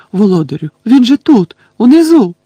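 very loud, high cry; panicked warning. A narrator's reading. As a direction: scream the alert to your master. Panicked follower warns her master. (панічний пронизливий крик)

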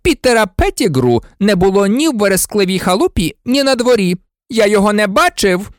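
high, emphatic denial; barking. A narrator's reading. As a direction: insist angrily on what was seen. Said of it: "гаркнув Снейп".

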